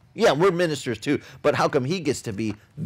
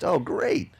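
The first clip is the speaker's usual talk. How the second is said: sarcastic. Retold or imitated. Aaron's sarcastic reaction.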